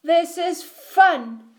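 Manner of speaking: high, drawn-out delight; gleeful exclamation. A narrator's reading. All the animals riding bikes exclaim together, gleefully. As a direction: exclaim it high and slow, relishing it.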